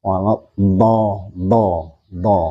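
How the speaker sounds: "do" flowing out unblocked and drawn out; smooth-flowing dhad. Shown as a demonstration. Teacher showing students the letter's smooth sound, flowing "do". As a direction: let each "do" flow without holding back. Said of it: "Suaranya lancar".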